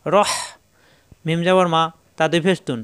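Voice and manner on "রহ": রহ as 'ro' closed by a strong throat h; pressed H, rounded R. Shown as a demonstration. Teacher repeating the model for learners, rounded vowel with pressed H. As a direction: round the vowel of রহ, then squeeze out the h. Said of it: "কণ্ঠনালীতে চাপ দিয়ে".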